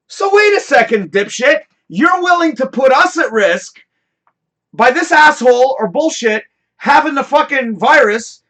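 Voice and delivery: high, loud, furious outburst; angry rant. Real, not acted. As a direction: let the outrage boil over.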